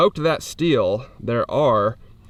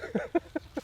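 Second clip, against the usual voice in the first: breathy laugh; 12 semitones higher, 11 dB quieter than usual. Real, not acted. Laughing after the recoil.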